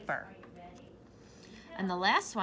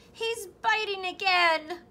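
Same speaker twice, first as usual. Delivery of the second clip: a high, much louder, slower squeal; 8 semitones higher, 8 dB louder than usual. A narrator's reading. Pig with ear bitten squealing for help, loud high squeal. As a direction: shriek it out in alarm. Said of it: "Piggy squealed".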